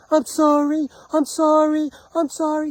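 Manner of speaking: very high, loud, repeated wail of apology; screamed apology. Retold or imitated. Man drowning out neighbors who talk over him, re-enacted screaming. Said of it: "So I kept screaming".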